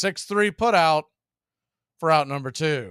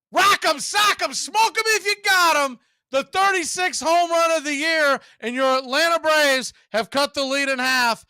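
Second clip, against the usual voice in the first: big, loud, much higher shouted home run call; 8 semitones higher, 4 dB louder than usual. Real, not acted. Announcer calling a home run to listeners, shouting with joy. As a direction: erupt as the ball leaves the park and keep riding it.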